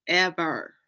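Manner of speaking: ordinary delivery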